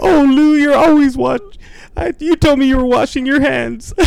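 very high, loud scolding mom; hammed-up shrieky mom impression; Martha. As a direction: play a scandalized mother wailing at a son. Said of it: "That's our Martha and Lou impression".

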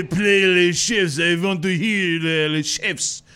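slow, hammed-up French accent; bad French accent. Cohost mocks Parisians with a slow bad French accent. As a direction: ham up a terrible French accent. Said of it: "That was a terrible French accent".

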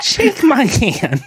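whiny nerd trying to sound tough. Retold or imitated. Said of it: "general nerd voice".

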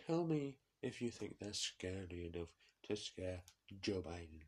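usual speaking voice

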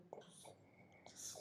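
breathy whispered reply; whispering back nervously. Retold or imitated. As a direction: say it under your breath.

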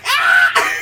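high shrieking mock scream; loud laughing shriek. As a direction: shriek like the woman in the scene. Retelling woman eating guitar.

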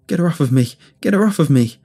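loud, high cry for help; panicked shout. A narrator's reading. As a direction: shout to be rescued from an attacker. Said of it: "I shouted".

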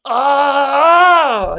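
loud high frustrated oh; real strained groan of effort; exasperated groan. Real, not acted. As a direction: let out the frustration. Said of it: "it's so hard".

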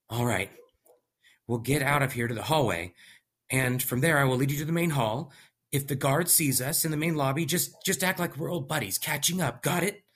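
lightly voiced anxious scientist; a hint of a tense planner; nervous young scientist. Scientist gives instructions.

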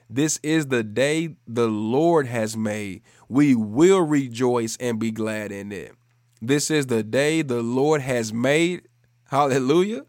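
emphatic, measured scripture proclamation; preaching scripture. Real, not acted. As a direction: declare the verse with conviction.

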